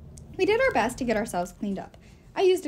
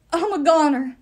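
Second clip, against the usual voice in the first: croaked; 6 semitones higher, 11 dB louder than usual. Acted. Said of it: "Blitz croaked".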